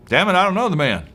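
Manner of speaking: high, loud, acted outburst; cursing denial. Peter denying Jesus to his accusers, an acted angry curse. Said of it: "We're told he cursed".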